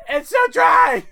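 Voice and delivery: high-pitched loud comic shriek; mock screaming. Podcast host voicing sperm out of place, mock screaming. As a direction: cry out in hammed-up agony. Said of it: "They're all just screaming".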